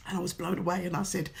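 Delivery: ordinary talk, nothing marked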